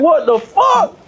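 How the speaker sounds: high, startled what the fuck; shocked exclamation. Retold or imitated. Telling his boy about the mule, high startled exclaim. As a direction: jolt up in disbelief. Shocked reaction in retold scene.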